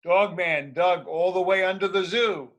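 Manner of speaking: usual speaking voice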